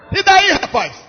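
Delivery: usual speaking voice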